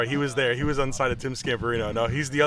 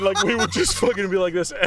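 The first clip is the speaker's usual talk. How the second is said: ordinary delivery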